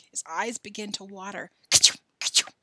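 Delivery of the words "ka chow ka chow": loud, explosive mock sneeze; acted sneeze. Joe imagining Billy sneezing on pepper, explosive mock sneeze. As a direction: burst out with a fake ka-chow. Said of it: "all of a sudden he'd sneeze".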